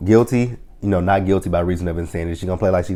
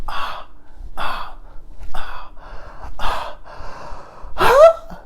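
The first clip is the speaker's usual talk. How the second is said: mock moans; much slower than usual. Mock moaning after whispered fantasy.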